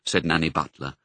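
plain reading voice

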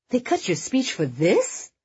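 high-pitched, indignant disbelief; incredulous outrage. A narrator's reading. Rachel objecting to the cut speech, incredulous and indignant. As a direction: rise sharply into an appalled question. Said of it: "Rachel was furious and yet trying desperately not to laugh".

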